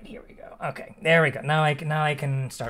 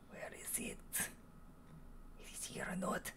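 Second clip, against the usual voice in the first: whispering; 23 dB quieter than usual. Real, not acted. GM searching, muttering.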